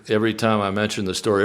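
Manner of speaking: ordinary talk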